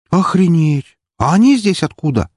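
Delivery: high, loud shocked exclamation; astonished. Acted. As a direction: blurt it out, stunned by the sight. Crew suddenly spots enemy ships.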